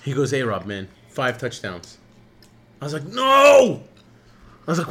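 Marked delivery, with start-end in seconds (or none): 0.3-2.0 low, mumbled imitation of a friend; a light low voice for the friend; imitating the guy. Retelling friend's quote. 3.0-3.8 sharp, higher and louder no; retold shout of shock. Retold reaction to bad news.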